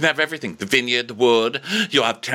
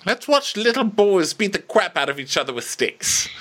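mock king; 12 semitones higher than usual. Mocking the king's pleasure.

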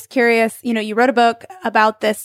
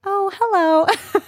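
high, drawn-out mock wife voice; really high-pitched imitation; 9 semitones higher, much slower than usual. Woman imitates how a husband might mimic his wife, really high-pitched. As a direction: pitch it way up, slowly, like a teasing impersonation. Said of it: "make it really high pitched".

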